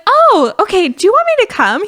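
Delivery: high, loud, bright eagerness; keen friend offering to come; eager okay. Retold or imitated. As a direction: jump at the chance to help. Suddenly eager to help.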